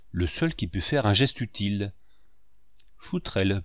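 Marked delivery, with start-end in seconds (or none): none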